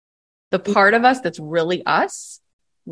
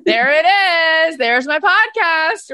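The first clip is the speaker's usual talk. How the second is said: high, slow and louder; delighted; 9 semitones higher, 4 dB louder, slower than usual. Real, not acted. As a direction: savor the reveal.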